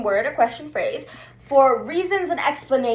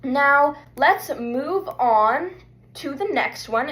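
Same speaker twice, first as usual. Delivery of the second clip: ordinary talk, nothing marked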